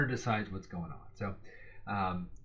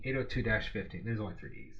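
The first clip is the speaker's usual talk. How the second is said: normal talking voice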